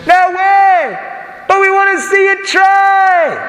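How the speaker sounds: high, slow jeering shout from the back; twisted heckler. Retold or imitated. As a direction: yell it like the sicko in the crowd who wants a show. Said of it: "one twisted individual in the back".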